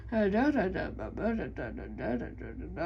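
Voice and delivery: monotone droning da da; robot voice. Retold or imitated. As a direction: drone it like a bored robot. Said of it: "I sound like a robot".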